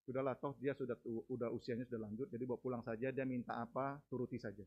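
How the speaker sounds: low, soft, a hint of a resigned doctor; lightly voiced doctor giving up gently. Doctor tells family to give up.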